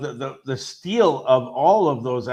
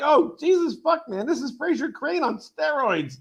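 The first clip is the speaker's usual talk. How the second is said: much higher, thrilled outburst; excited exclamation; 11 semitones higher than usual. Retold or imitated. Recalling watching Beast on screen, thrilled. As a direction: burst out in delight. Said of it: "And I'm like".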